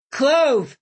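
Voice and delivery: mild acted shout upward. A narrator's reading. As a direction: call out her name to someone far off. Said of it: "Sam shouted".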